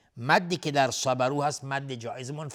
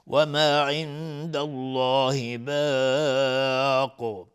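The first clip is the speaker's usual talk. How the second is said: slow, drawn-out chanted recitation; Quran recitation; 6 dB louder, much slower than usual. Shown as a demonstration. Teacher reciting the verse for students in slow chant. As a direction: intone the verse slowly with full tajweed.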